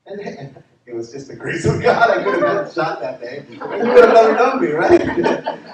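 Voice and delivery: loud, laughing through the words. Real, not acted. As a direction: laugh off the close call as he tells it. Laughs at nearly being shot.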